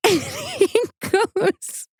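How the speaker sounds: slow, high squeal through laughter; barely getting the words out for laughing. Real, not acted. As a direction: crack up while talking. Cracks up while telling story.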